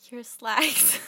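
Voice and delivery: loud, breaking up on the last word; giggly. Real, not acted. Voice actor failing another take, cracking up. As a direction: burst out laughing on the line. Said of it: "all, like, giggly".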